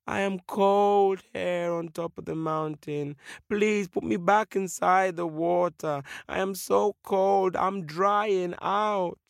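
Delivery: high, slow, plaintive wail of a voice; pleading fish. Acted. Freezing fish begs the eagle, slow high plea. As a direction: beg pitifully from the cold.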